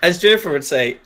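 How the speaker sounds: usual speaking voice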